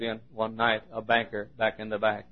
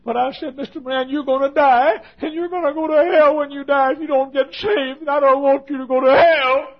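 high, loud shouted warning; full-force preaching; 12 dB louder than usual. Retold or imitated. Preacher warning Mister Moran he will die unsaved, full-force shout. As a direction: preach it at the top of your voice.

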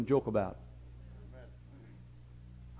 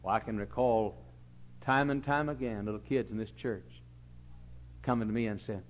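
usual speaking voice

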